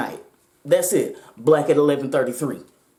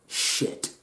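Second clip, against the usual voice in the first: breathy whispered despair under her breath; whispered curse; 8 dB quieter than usual. Retold or imitated. Thinking she will die, a whispered curse. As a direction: barely voice it, all air. Inner thought at gunpoint.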